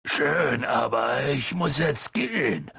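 slow giant's voice; Hagrid taking leave. A narrator's reading. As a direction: draw out the goodbye in a giant's rumble. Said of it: "sagte Hagrid".

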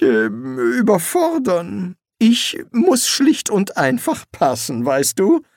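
high, nervous, hesitant wizard. Acted. Rincewind claims to be a weak demon, high and nervous. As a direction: talk your way out, stammering. Rincewind pleads his case.

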